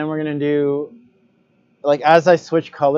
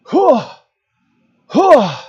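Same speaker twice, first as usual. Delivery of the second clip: put-on old man Odin voice; 11 semitones higher, 8 dB louder than usual. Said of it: "You like my old man Odin voice?".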